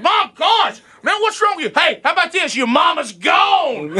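high, exasperated mock scolding; half-laughing mock exasperation. Grandpa scolding the toddler after a throw, high and exasperated. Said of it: "you find yourself holding it going".

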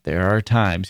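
usual speaking voice

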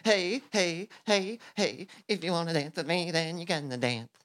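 high, fast, chanting dance taunt; sing-song taunt. Acted. Toad dancing on stage, taunting Frog in a sing-song. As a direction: tease him like a show-off.

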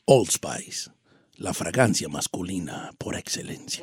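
very low, soft masculine ad read; sexy announcer voice. Shown as a demonstration. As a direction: drop deep and hushed, like a commercial announcer. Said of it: "Voz sexy aquí, Don Cheto".

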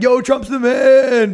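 a hint of a fanboy; mock hype. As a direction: hype him up like a fan. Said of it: "everyone was like".

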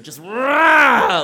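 sudden loud high roar as she shoves carrots; mock angry roar. Russian deli woman roaring while juicing carrots, loud mock roar. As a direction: bark out a loud rah.

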